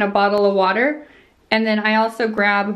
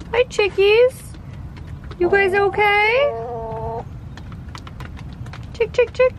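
high, bright chicken call; 13 semitones higher than usual. Real, not acted. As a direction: sing out to the hens. Greeting and calling chickens.

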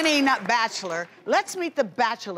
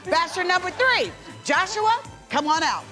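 dating show host; 5 semitones higher than usual. Acted. Host calls out a contestant.

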